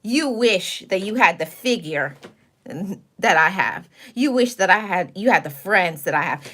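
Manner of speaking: normal talking voice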